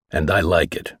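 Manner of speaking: low, cold and curt. A narrator's reading. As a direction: shut him down coldly. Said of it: "said Mel coldly".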